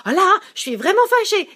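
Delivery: put-on high, loud scolding of the kids; mock angry parent. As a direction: play the fed-up mother scolding. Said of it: "t'as envie de dire". (mère fâchée qui gronde ses enfants, voix haute et forte)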